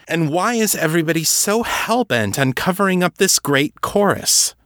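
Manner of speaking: heated, high-pitched demand; snapping. A narrator's reading. Kate demanding Levinson tell her everything, snapping. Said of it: "Kate snapped".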